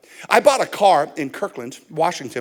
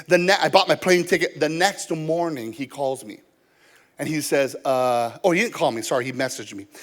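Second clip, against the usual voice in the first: ordinary talk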